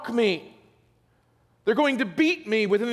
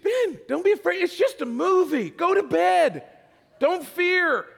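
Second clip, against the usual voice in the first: much higher, sing-song dismissive comfort; mock big brother; 10 semitones higher than usual. Older brother brushing off scared Ben, mock sweet and high. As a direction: play the big brother shooing him to bed.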